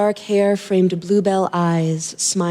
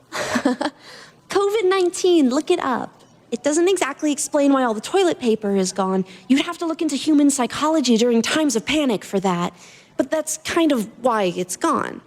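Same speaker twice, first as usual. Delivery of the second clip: put-on higher, light young woman's voice; girl's voice; 5 semitones higher than usual. Girl teasing Adrian about the toilet paper, light young voice. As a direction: voice the cheeky girl in the store.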